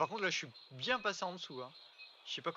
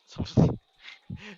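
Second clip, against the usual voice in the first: loud breathy laugh, higher; 6 semitones higher, 15 dB louder than usual. Real, not acted. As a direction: crack up at the mistake. Laughs after in-game mistake.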